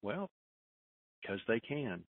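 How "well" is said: usual speaking voice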